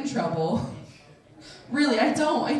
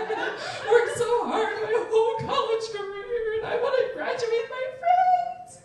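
high, hammed-up crying plea; mock blubbering that climbs to a squeal; bawling; 12 semitones higher than usual. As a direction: wail apologies and excuses as if sobbing. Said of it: "just bawling".

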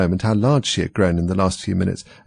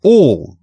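"All" hit high and loud; king glaring at Alice, stressing "All" hard; great emphasis; 8 semitones higher, 8 dB louder than usual. A narrator's reading. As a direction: punch the word "All". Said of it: "he repeated with great emphasis".

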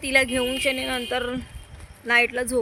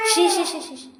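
disgusted; 5 semitones higher than usual. Real, not acted. Mother stopping child from touching garbage, disgusted. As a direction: recoil in disgust.

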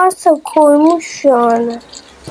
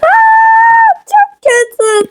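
high shocked wail; sudden high dismayed cry; 3 dB louder, much slower than usual. Real, not acted. Teammates die.

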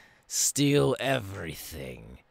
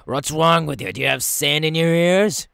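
loud, higher impatient yell; angry shout; 7 semitones higher, 11 dB louder than usual. Acted. Impatient captain yells at captive.

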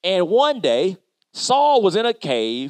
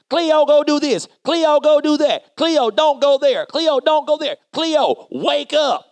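higher, louder barking orders as the boss; mean bossy supervisor; 10 semitones higher, 4 dB louder than usual. Retold or imitated. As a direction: play the nagging supervisor bossing Cleo around. Bossy supervisor voiced in story.

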